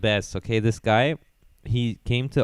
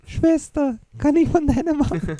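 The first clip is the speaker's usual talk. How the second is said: much higher put-on German, pleading; comic German delivery; 3 dB louder than usual. Jamel asking the lady again, high mock German. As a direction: squeak it out like the comic's punchline. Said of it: "like, the way he said it".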